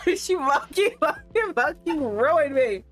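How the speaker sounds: wheezing with real laughter; laughing. Real, not acted. Streamer undone by her own reply, high real laughter.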